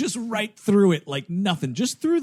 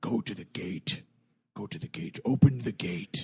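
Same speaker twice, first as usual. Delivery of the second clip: low, menacing game narrator; scary villain voice; 9 semitones lower, 6 dB quieter than usual. Retold or imitated. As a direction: loom over him like a horror host. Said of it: "I keep having to break my scary voice".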